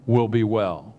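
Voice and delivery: usual speaking voice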